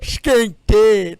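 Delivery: loud mock quote. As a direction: bark the quote back. Echoing the movie line.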